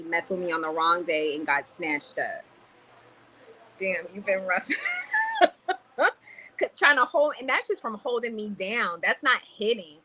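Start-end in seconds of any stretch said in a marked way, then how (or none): 4.7-6.1 very high, squealing laugh; real laugh. Real, not acted. Reacting to chat saying she's been rough, real laugh. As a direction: burst into a long high cackle.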